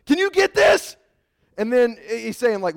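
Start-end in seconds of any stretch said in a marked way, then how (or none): 0.1-1.0 much higher, loud frantic shouting; panicked crowd shout. Acted. Terrified people at the mountain shouting to Moses. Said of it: "people were like".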